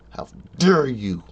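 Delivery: loud indignant challenge; a touch of mock outrage; angry. Imagined confrontation with intruder.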